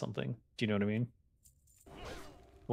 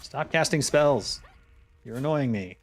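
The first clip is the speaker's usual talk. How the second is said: annoyed command; 4 semitones higher, 4 dB louder than usual. Real, not acted. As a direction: tell the caster off sharply. Yelling at in-game caster.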